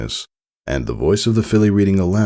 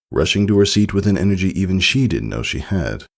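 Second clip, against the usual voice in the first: plain reading voice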